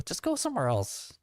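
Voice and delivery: squeaky high mocking shoo. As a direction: shoo them off in a high sneer. Repeats dismissal in high voice.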